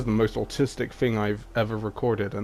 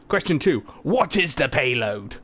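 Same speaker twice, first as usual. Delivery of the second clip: quiz host; 4 semitones higher than usual. Acted.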